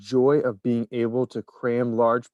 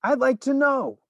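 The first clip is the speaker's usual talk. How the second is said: angry shout; 3 dB louder than usual. A narrator's reading. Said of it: "a large boy shouted angrily".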